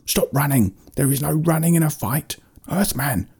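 indignant mock yell in a high creature voice; angry shouting. A narrator's reading. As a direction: complain up high as an outraged creature. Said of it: "started shouting at her to slow down".

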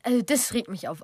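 usual speaking voice

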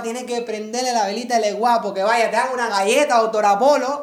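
hammed-up Cuban street accent. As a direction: friend playing a loud Cuban accent. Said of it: "Eso es el acento".

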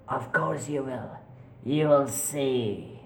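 slow, smug eerie voice; ghostly taunt. Acted. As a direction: promise it slowly. Voices replying.

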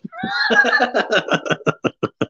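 high-pitched peal of laughter; real laughter. Real, not acted. Teasing over the accent as a ringtone, high laughter. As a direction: laugh long and high, tailing down.

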